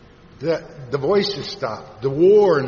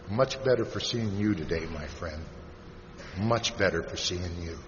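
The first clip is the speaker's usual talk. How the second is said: soft, low, heartfelt greeting; tender reassurance; 9 semitones lower, 8 dB quieter than usual. Retold or imitated. Friend greeting a struggling man at a meeting, low and tender. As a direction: say it gently, dropping low and soft.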